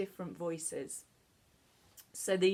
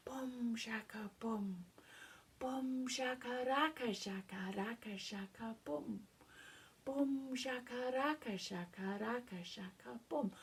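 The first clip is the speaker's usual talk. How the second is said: soft, breathy, half-whispered jitters; breathy nervous voice; 3 semitones higher, 9 dB quieter than usual. Shown as a demonstration. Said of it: "in a breathy, nervous person's voice".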